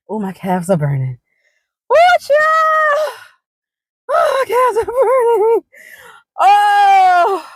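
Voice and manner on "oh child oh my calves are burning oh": high, loud drawn-out hollering; loud wail. Real, not acted. Walker back at car with burning calves, loud wail. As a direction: wail over aching legs at full voice. Wailing about aches after walk.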